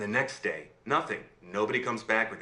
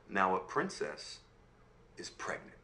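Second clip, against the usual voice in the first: plain reading voice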